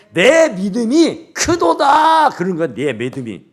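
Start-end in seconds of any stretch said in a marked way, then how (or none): 0.1-2.3 내 믿음이 크도다 stretched out slowly, pitched higher and louder; proclaimed slowly. Acted. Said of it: "내 믿음으로 고치는 거라니까요".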